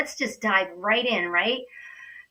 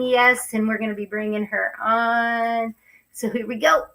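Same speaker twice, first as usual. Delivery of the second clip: normal talking voice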